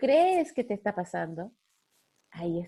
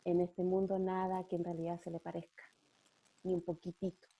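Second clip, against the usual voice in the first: tender; 7 dB quieter than usual. Real, not acted. Tender description of God's love.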